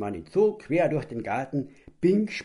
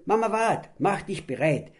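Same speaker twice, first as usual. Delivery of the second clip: calling out; 8 semitones higher, 4 dB louder than usual. Acted. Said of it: "hörte man den Papa schreien".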